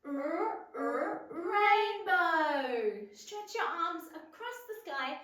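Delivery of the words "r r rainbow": slow R, r, rainbow; stretched R sound. Shown as a demonstration. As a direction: draw out the R in rainbow. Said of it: "r sound".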